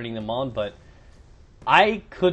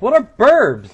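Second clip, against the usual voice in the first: loud, high mock outburst; exasperated; 11 semitones higher, 13 dB louder, slower than usual. Girl fed up with a troll's nonsense, exasperated. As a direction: imitate her loudly demanding an explanation. Acts out girl's growing bafflement.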